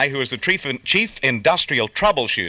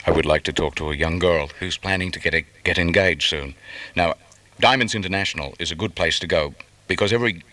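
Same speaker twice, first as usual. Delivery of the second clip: intimate ad read; 7 semitones lower than usual. Acted.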